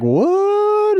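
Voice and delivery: high-pitched "What?" in mock disbelief; comic squeal of disbelief; shocked outcry. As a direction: play a whole country reacting, stunned and high. Voicing a shocked America.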